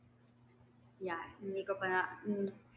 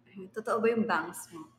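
ordinary talk, nothing marked

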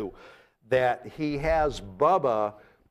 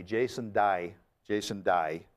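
Australian "Day", said like "die"; Australian accent; 4 semitones lower than usual. Shown as a demonstration. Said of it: "that's Aussie".

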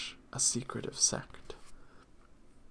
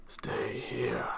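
breathy, commanding holy-man voice; ordering. A narrator's reading. Shrive to his overseers at the egg, breathy command. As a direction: give a hushed, drawn-breath order. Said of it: "the Shrive ordered".

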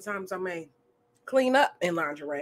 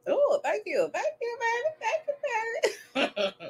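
high sweet thanks; 13 semitones higher, 4 dB quieter, slower than usual. Real, not acted.

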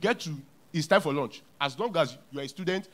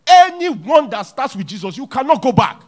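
loud, high preaching; full-force sermon shout; 8 semitones higher, 13 dB louder than usual. Acted. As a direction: proclaim it to the crowd.

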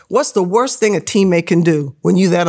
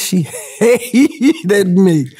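laughing; 2 semitones higher, much slower than usual. Real, not acted. Laughing while telling of coach.

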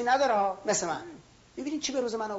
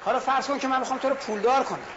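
ordinary talk

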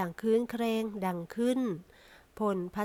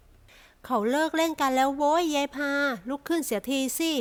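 put-on high, loud old lady's call; an elderly mother loudly calls her daughter to stop pretending; 5 semitones higher, 5 dB louder, slower than usual. A narrator's reading.

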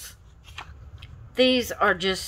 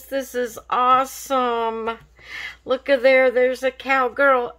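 normal talking voice

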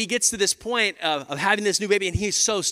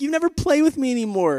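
imitating sad little son; 8 semitones higher, 3 dB louder than usual. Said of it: "You know, like".

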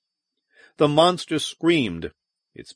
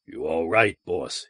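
gruff concern; 6 semitones lower, 4 dB quieter than usual. A narrator's reading. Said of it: "he asked".

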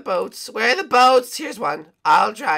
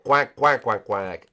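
low mock penguin quacks, sinking lower; 9 semitones lower, slower than usual. Penguin quacks back at friends, low mock quack.

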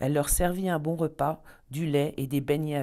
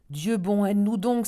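crying out; 5 semitones higher, 5 dB louder than usual. Acted. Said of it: "s'écria-t-elle".

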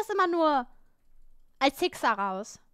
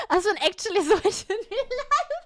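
higher, louder words through laughter; laughing; 7 semitones higher, 5 dB louder than usual. Real, not acted. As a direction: burst out laughing while admitting it. Laughs at own cat act. (lachend gesprochen)

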